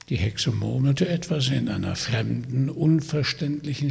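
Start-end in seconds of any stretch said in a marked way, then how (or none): none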